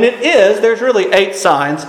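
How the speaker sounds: normal talking voice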